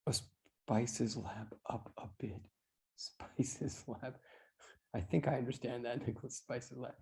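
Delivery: very soft, low murmur; hushed, low reading to oneself; muttering to self. Real, not acted. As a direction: puzzle it out under your breath. Puzzling over a student's message.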